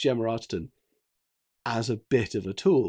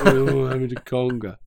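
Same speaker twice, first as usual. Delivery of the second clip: louder, hammed-up misery with a laugh behind it; mock pained; 6 dB louder than usual. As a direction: imitate someone suffering through forced fun. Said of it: "just this picture of pain".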